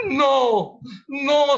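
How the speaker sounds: loud panicked double no; a professor yelling no in fear; panicked shout. Acted. To the boatman, a panicked loud no.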